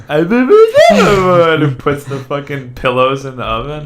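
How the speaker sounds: high, loud movie line impression; movie quote voice. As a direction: quote it like a goofy movie character. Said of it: "That's like Step Brothers shit".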